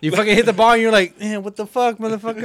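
loud, high, fast mock yell at the pitcher. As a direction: shout it out like a fired-up hitter. Player yelling after bat flip.

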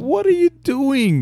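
high, loud, drawn-out disbelief; incredulous outburst. Real, not acted. Friend reacting to the self-inflicted scar, incredulous outburst. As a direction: scold a friend in loud, slow astonishment. Friend scolds the storyteller's habit.

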